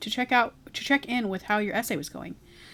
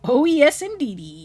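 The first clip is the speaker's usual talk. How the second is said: excited; 5 semitones higher, 7 dB louder, slower than usual. Acted. Guard greets new member.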